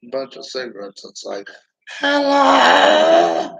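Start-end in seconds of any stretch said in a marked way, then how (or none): 2.0-3.5 much higher, much louder shrieked hello; loud high mock smoker's screech; smoker woman impression. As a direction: caricature a chain-smoking woman yelling hello. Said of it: "sounded like a woman that was smoking".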